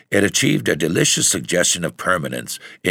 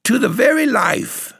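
soaring high-pitched triumphant cry; exultant; 4 dB louder than usual. A narrator's reading. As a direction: cry it out, exulting even more on "very". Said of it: "she exclaimed aloud exultantly".

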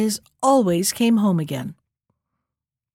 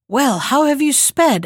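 eager; 4 semitones higher, 3 dB louder than usual. Acted. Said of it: "asked her son eagerly".

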